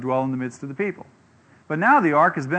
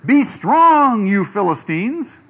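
loud, high rallying cry, then slowing down; cheerleader rallying; 5 semitones higher, 6 dB louder, much slower than usual. Retold or imitated. A cheerleader rousing the frightened Philistines, a loud high rallying cry. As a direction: pump up a scared crowd. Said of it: "Some cheerleader stands up and says".

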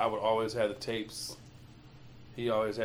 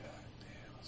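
awed hush; 19 dB quieter than usual. Retold or imitated. Quoted awe, unvoiced.

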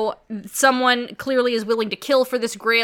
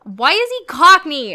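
louder, exasperated why-question; 4 semitones higher, 7 dB louder than usual. Real, not acted. Friend protesting the cockney villain, exasperated and louder. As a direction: complain loudly about the accent. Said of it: "I hate it".

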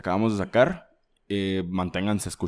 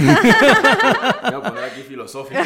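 laughing; 13 semitones higher, 10 dB louder than usual. Real, not acted. Laughing while announcing philosophy.

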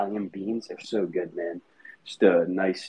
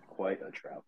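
whispered aside; 11 dB quieter than usual. Pretend. Repeats his own joke aside.

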